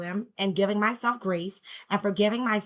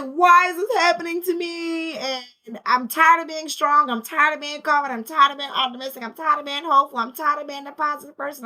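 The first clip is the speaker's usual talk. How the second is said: higher-pitched, overwhelmed venting; wail, then list it off, acting out exhaustion; retold breakdown; 5 semitones higher than usual. Mother re-enacting her tearful hallway blow-up, overwhelmed complaint. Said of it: "I just blew up".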